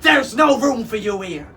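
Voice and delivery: high, loud rejection; angry pirate shout; captain's yell. A narrator's reading. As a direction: yell it to drive the parrot off. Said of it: "he yelled".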